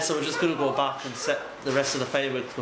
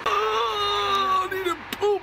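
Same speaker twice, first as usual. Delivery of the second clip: desperate squeal; much slower than usual. Retold or imitated. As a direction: squeal like you're bursting for the loo. Mocking woman needing toilet.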